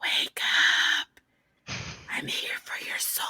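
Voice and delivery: spooky whisper in their ear; creepy whisper. Retold or imitated. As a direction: whisper like a ghost come for their soul. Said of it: "Mom, that's creepy".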